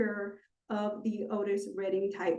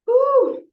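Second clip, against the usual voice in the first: whew; 14 semitones higher, 8 dB louder than usual. Real, not acted. Exhales after calming herself down.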